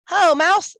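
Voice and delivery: high, loud hare greeting; cheery hare voice. A narrator's reading. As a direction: call out like a jolly hare.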